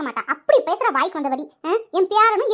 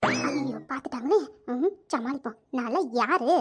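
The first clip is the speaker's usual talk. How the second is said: low and much softer, under the breath; hushed aside; 6 semitones lower, 6 dB quieter, slower than usual. Acted. Grandmother caught eavesdropping mutters to herself, low and hushed. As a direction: mutter it, caught out but cocky.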